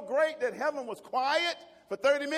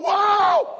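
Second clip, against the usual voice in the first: loud, high panicked yell; not calm; 8 semitones higher, 12 dB louder than usual. Retold or imitated. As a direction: holler at a runaway horse. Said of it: "I wasn't calm".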